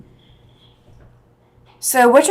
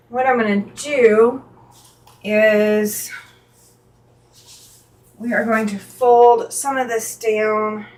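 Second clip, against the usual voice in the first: usual speaking voice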